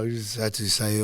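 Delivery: ordinary delivery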